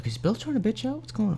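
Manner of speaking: high, hushed voice of gossiping friends; onlooking friends. Acted. Game master voicing friends watching Bill, softly doubting him. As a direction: murmur it high and soft among the watchers. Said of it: "all your friends, yeah, they're looking at you".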